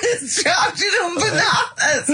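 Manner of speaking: high shrieking words through real laughter; laughing. Real, not acted. Host repeating the banana punchline, shrieking with laughter. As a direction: gasp the punchline back while howling.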